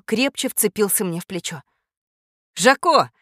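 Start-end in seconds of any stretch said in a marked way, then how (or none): 2.5-3.1 calls the parrot's name, louder, higher and amazed; a startled owner crying out the bird's name; astonished call. Acted. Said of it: "изумился хозяин".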